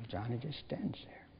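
"Johnny just stands there" whispered, no voice. Shown as a demonstration. Preacher hushing the story as the boy stands silent, whispering. Narration retold, dropped to whisper.

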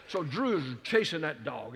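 ordinary delivery

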